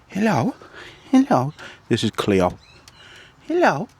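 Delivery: dog voice. Real, not acted. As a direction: coo up high at the dog. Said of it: "I am gonna be using my dog voice".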